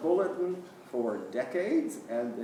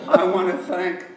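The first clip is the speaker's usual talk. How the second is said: still laughing; 3 semitones higher, 14 dB louder than usual. Real, not acted. Still laughing after joke.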